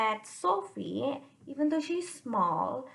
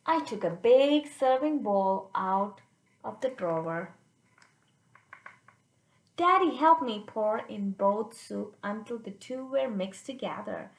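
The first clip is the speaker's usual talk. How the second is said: put-on lively read-aloud for kids; expressive children's story reading; storybook narration; 3 dB louder than usual. A narrator's reading. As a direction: read it out like a picture book to children. Reads the picture book aloud.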